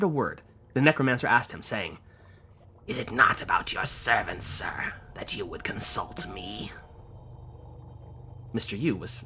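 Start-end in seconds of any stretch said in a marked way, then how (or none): 2.9-6.7 eerie whisper. Acted. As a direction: hush it like a sinister fortune teller. Necromancer asks Mister Yu.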